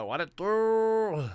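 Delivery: high, slow, put-on homie voice; goofy high voice. Greeting his friend in a silly high voice.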